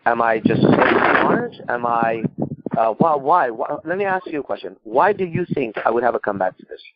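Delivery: ordinary talk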